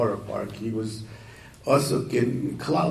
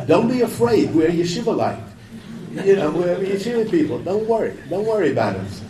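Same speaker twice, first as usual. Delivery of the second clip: put-on high and louder, soothing the girls; reassuring in English; 7 semitones higher, 5 dB louder than usual. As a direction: put them at ease, voice lifted high. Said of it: "he says".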